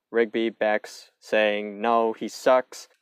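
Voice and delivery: ordinary talk, nothing marked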